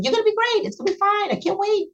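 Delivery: cheerful reassurance. Retold or imitated. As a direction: lift her up, sound upbeat for her. Mother reassures her dying daughter.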